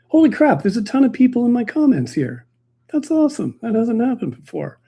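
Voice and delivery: happy astonishment at the comments; surprised delight. Real, not acted. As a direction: react like you just noticed a crowd arrived. Suddenly sees many live comments.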